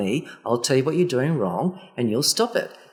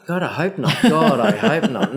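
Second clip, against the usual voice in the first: louder laughing protest; 5 semitones higher, 6 dB louder than usual. Real, not acted. Reacting to being called a Karen, laughing. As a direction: laugh it off in dismay.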